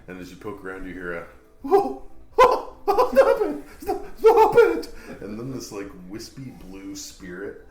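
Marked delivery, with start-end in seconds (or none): 1.6-4.9 being tickled. Acted. Ghost being tickled, squealing.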